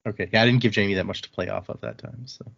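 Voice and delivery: ordinary talk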